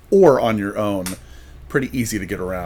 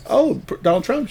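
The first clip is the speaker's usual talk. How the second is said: surprised question; 12 semitones higher than usual. Retold or imitated. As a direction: voice jumps much higher in disbelief. Surprised reply to Trump fan.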